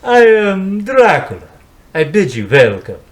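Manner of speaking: slow, drawn-out Dracula greeting; Bela Lugosi impression. Greeting his guest as Dracula, slow Lugosi voice. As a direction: play the Count bidding welcome. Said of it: "how about Bela Lugosi?".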